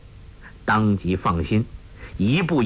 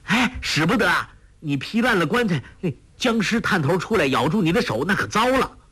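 higher, urgent frightened warning; alarmed shout; 7 semitones higher than usual. A narrator's reading. 杨康 stops 黄蓉 striking the coffin, alarmed. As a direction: cry out to stop the palm strike. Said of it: "叫道".

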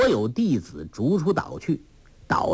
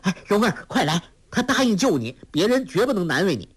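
high, eager, fast rush of joy; a boy relieved his friend is safe; overjoyed; 8 semitones higher, faster than usual. A narrator's reading. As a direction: blurt it out in delight. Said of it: "郭靖大喜道".

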